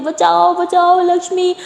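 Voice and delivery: high, stammering cry for help; panicked scream for help. A narrator's reading. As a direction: yell for help after finding a body. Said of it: "ज़ोर से चिल्लाने लगती है".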